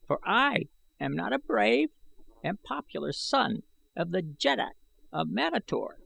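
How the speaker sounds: put-on high, thin voice of a scheming old man; sly old man. A narrator's reading. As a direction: let the old man slip in the treason. I Gos the old taxidermist.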